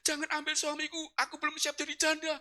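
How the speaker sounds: high, wailing prayer; imitated tearful plea. Wife prays for her husband's life, imitated tearful plea. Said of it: "Istri saya nangis".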